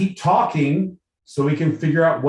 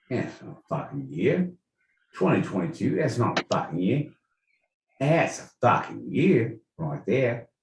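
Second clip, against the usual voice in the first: put-on Aussie accent; 2 semitones lower, 6 dB quieter than usual. Guest answers the year question in an Aussie accent. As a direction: answer like an Australian, rising on "year". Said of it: "To answer in an Aussie accent".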